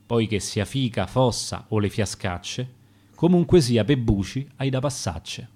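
ordinary delivery

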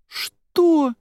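very high, drawn-out shocked question; high-pitched astonished "what"; incredulous. A narrator's reading. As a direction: let it leap up in disbelief. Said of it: "девушка расхохоталась".

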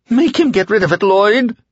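terrified villain whining for help; whining dark man. A narrator's reading. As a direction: plead in a panicked whine.

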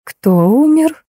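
slow, high, stunned question; stunned disbelief. Acted. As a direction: ask as if the ground gave way. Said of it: "Альбина рухнула на диван".

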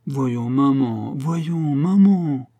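drawn-out, climbing plea to mother; guilty schoolboy pleading. A narrator's reading. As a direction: whine it slowly like a caught child. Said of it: "d'un air d'écolier en faute".

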